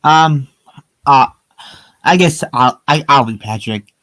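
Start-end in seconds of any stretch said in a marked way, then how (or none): none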